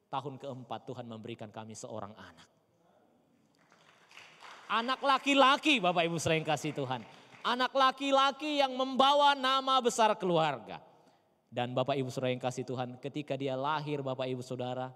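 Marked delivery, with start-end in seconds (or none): 4.7-10.8 triumphant preaching. Acted. Pastor loudly proclaims the son who carries the family name. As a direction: proclaim it like a victory.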